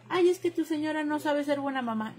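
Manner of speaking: high-pitched imitation of a tattling critic; put-on high voice of criticism; mock complaining relatives. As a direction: mimic their nagging complaint, pitched well up. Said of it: "Me dicen".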